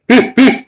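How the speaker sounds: high, loud yappy bark; loud imitation dog yap. Shown as a demonstration. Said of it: "I could do a dog".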